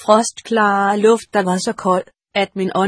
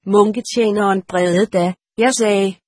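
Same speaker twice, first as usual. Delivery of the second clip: ordinary delivery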